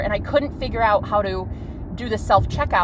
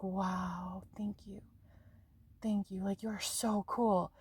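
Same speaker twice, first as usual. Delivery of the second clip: very soft, low, half-whispered amazement; hushed awe; 4 semitones lower, 15 dB quieter, slower than usual. Retold or imitated. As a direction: murmur it low, as if awestruck. Awed reaction retold to the man.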